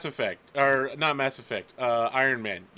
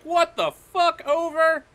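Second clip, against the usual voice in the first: higher and louder, furious; furious midshipman; 9 semitones higher, 4 dB louder than usual. Acted. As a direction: curse the colonel over the radio. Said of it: "He's just like".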